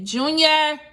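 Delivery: loud high call across the house. Real, not acted. Calling to Junior from another room, loud call. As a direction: shout the name to fetch him.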